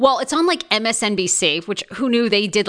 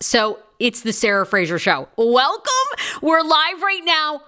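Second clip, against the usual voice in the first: ordinary talk, nothing marked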